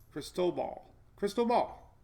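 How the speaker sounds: "crystal ball" said as one name, crees-toh-BAHL; blended pronunciation. Shown as a demonstration. Trying out the guide's version for viewers, blended pronunciation. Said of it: "pronunciation guide".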